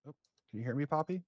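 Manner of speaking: loud, high call to someone unresponsive; calling out loudly. Acted. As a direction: shout her name to wake her. Calling to unconscious patient.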